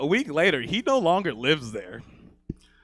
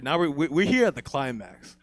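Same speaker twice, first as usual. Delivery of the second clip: usual speaking voice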